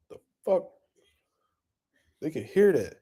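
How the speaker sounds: hushed whisper; under-the-breath whisper. Real, not acted. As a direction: whisper it so nobody hears. Whispers, fearing he's overheard.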